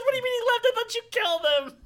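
imitating a flabbergasted father, high and loud; incredulous dad. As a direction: demand an explanation in shrieking disbelief. Bewildered dad challenges son's story.

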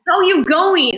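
high, loud mock Australian "How are you going?"; playful Australian accent impression; imitating Aussie greeting. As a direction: sing it up like an Aussie local. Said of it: "they say, like".

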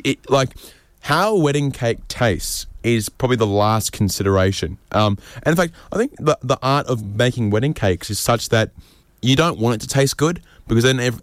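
usual speaking voice

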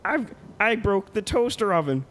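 put-on high nasal man-child; prissy high-pitched host; Pee-wee Herman voice. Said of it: "man of a thousand voices".

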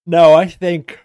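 talking through a yawn; words stretched by a yawn. Real, not acted. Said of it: "Yawning, thinking about the Rams' backfield".

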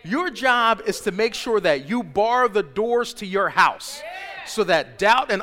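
slow, loud, driving each word; preaching shout. Real, not acted. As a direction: hammer the charge home.

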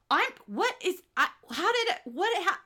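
half-laughing shock at a man who walks away; confused disbelief. Retold or imitated. Stunned, halting disbelief with a laugh. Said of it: "it's like whiplash".

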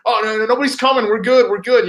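hammed-up boastful buddy insisting he can drive; mocking Kark. Host teasing cohost about his driving, cocky mock voice. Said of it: "he's like".

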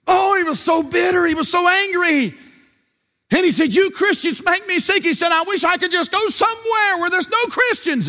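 high, loud preacher's shout; preaching shout. Acted. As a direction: shout it from the pulpit, high and hard.

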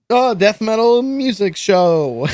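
high and slow, dismissive; mock bland title. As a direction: say it like a lame generic name. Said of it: "You didn't just say".

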